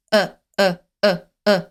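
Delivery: "uh" in short, separate pops; quick, clipped "uh" with the tongue low; quick schwa. Shown as a demonstration. Teacher showing students the right schwa, quick and short. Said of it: "like, a quick, uh".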